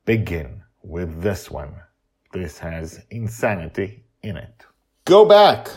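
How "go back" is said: loud, high go back; shouted warning; emphatic command. Real, not acted. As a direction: order them back firmly. Warning new listeners.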